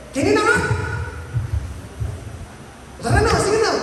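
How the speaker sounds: playful high invitation to share food; high coaxing invite. Retold or imitated. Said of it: "Sabihin mo na lang".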